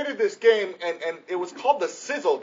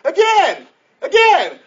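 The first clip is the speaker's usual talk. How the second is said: imitated toddler's high, loud shout; insistent toddler demand; 11 semitones higher, 10 dB louder, slower than usual. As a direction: beg for another round like a little kid. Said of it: "that's what kids always want".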